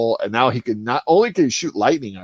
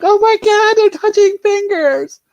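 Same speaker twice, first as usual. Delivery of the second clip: high, squealing swoon over nothing; mock fangirl squeal; 7 dB louder than usual. Mocking Reylo fans squealing over a finger touch. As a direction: gush like a starstruck fan, hammed up.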